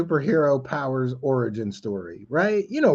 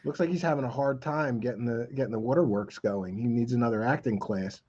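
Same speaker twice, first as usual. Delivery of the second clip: quiet sarcastic aside; 5 semitones lower, 9 dB quieter than usual. Real, not acted.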